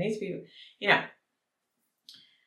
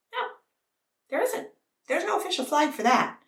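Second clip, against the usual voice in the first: ordinary talk, nothing marked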